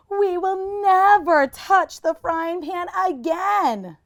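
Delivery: put-on slow, high, chorus of boys; solemn promise. Brothers promising Sadie together, slow. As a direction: promise it earnestly.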